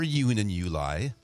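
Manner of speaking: slow "you and you lie" for June and July; "you and you lie", each J softened to Y. Acted. Joke punchline, June and July with Y.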